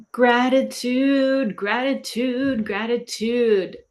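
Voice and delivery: slow, stretched out gratitude chant; drawn-out mantra. Shown as a demonstration. Coach modelling a slow gratitude mantra. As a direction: savor each gratitude slowly. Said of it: "There is always something to be grateful for".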